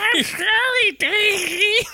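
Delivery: high squeaky cartoon voice; Meatwad voice. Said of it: "it's Meatwad telling Daisy".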